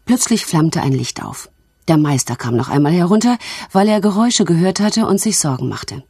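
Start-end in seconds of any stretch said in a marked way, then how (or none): none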